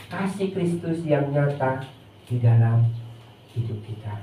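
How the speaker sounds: low, soft pastoral winding-down; hushed, earnest end of sermon; gentle closing exhortation. Real, not acted. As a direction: bring the congregation down quietly toward prayer. Gentle closing exhortation before prayer.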